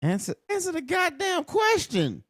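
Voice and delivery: high, exasperated demand; mock impatient Renee. Vlogger voicing Renee fed up with the interview. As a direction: nag him to answer at last.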